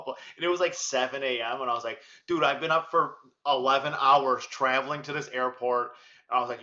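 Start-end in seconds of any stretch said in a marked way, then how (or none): 2.3-5.9 loud, indignant retold complaint; exasperated protest. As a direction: argue back at airport staff, louder. Retold argument with airport staff.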